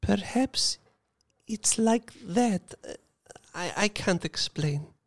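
high, slow and hesitant; drawn out, unsure; slow restrained voice. Acted. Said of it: "she said slowly".

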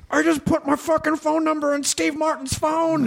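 imitating a giddy, very high squeal; hammed-up high-pitched glee. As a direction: squeal it like a starstruck friend bragging. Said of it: "get so giddy about something".

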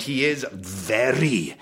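Welshman putting on posh, very slow; dad's posh voice. Retold or imitated. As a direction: stretch the words like an adamant, posh Welsh dad. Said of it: "puts on a rather posh voice".

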